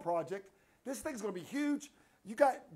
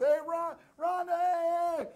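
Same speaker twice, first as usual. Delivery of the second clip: higher, louder happy hey to a friend; loud greeting; 9 semitones higher, 5 dB louder, slower than usual. Retold or imitated. Bursting in to wish Rhonda happy birthday, loud. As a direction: greet her brightly and loudly. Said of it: "my big mouth".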